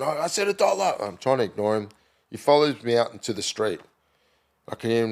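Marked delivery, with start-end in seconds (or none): 0.0-1.0 pushy hustler. Retold or imitated. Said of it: "he's going".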